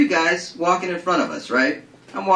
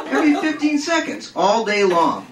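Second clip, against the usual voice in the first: plain reading voice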